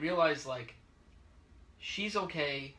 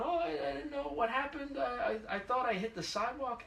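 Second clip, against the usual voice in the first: high, stammering mock-innocent excuse; sheepish driver; 11 semitones higher than usual. At-fault driver making excuses, in a high stammer. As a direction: whine it like you're playing dumb. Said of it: "The guy's going".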